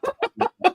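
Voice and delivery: high burst of laughter; kind gentle laugh. Real, not acted. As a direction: laugh out, amused. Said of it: "I'm not laughing at you".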